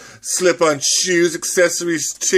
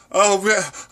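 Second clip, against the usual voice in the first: usual speaking voice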